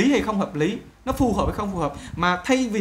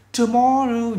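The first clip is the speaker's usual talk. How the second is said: "Tomorrow" high, with the middle syllable punched; stressed, stretched middle in "Tomorrow"; 4 semitones higher, 3 dB louder, much slower than usual. Shown as a demonstration. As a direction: lean on the "mor" of "Tomorrow". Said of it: "Nhấn là more".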